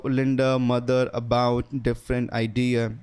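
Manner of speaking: careful slow pronunciation model; slow demo. Shown as a demonstration. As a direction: say it very slowly. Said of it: "कैसे pronounce किया था".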